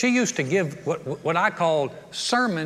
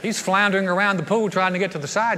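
ordinary talk

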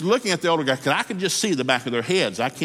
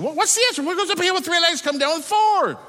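high, loud and pressing; exasperated demand; 3 dB louder than usual. Acted. Frustrated businessman pressing the old man for the answer, high and loud. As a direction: demand it, at the end of his rope.

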